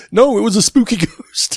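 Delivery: a touch of Randy's insistent dad voice; Randy impression. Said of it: "just go".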